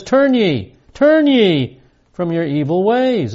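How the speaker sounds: high, urgent pulpit warning; emphatic warning. Retold or imitated. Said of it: "warning is emphatic".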